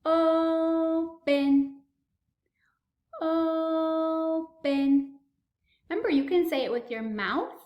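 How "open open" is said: teacher stretching out open; slow modeled word. Shown as a demonstration. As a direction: open drawn out slowly. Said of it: "Now we have to say".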